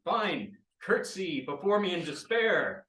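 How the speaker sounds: exasperated villain decree; grudging villain. Acted. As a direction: give in and demand it grandly.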